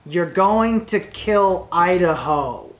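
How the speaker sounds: going punched hard and drawn out, a slow heavy warning; emphatic warning. Real, not acted. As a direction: stress on going, said slow and loud.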